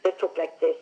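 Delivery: imitating her parents' German accent. Shown as a demonstration. Daughter imitating how her German parents talked, German accent. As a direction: talk with a German accent, as at home. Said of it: "my parents were German, and they spoke like this".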